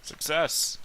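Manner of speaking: triumphant. Real, not acted. Player announces roll result.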